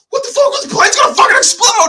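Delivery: very high, loud screaming panic; panicked freak-out. Acted. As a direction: shout it fast as if the plane will blow. Acts out character's panicked premonition.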